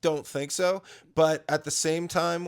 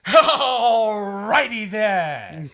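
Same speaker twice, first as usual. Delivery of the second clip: drawn-out, louder, higher zany drawl; Ace Ventura catchphrase; 5 semitones higher, 9 dB louder, much slower than usual. Retold or imitated. Asked for old-school Jim Carrey, zany stretched Ace Ventura line. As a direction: stretch it out cartoonishly. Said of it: "Ace Ventura".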